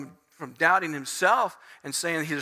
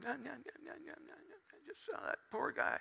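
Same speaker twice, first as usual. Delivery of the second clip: very soft, high taunting gibberish; hushed sing-song mockery; mocking onlookers; 6 semitones higher, 18 dB quieter than usual. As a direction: jeer under your breath at someone pitiful. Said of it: "just watching them going".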